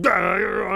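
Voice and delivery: high loud shocked gasp; startled cry. A narrator's reading. Forest spirit startled by Anansi, crying out. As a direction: jolt in surprise.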